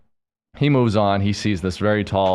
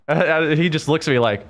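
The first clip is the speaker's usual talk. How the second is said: usual speaking voice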